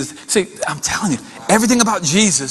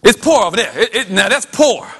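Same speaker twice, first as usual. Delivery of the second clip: normal talking voice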